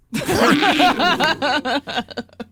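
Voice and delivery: loud cackle. Acted. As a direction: let out a wild cackle. Vampire character cackles.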